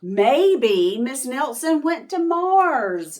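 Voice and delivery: put-on high, slow dreamy child's guess; wondering kid. Another kid guessing, high and slow. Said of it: "said another kid".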